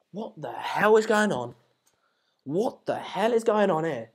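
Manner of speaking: slow, drawn-out disbelief; real, long-drawn bafflement; incredulous. Real, not acted. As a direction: stretch each word out in bewilderment. Baffled reaction to a substitution.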